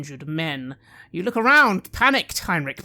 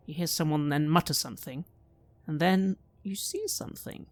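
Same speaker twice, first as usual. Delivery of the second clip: ordinary talk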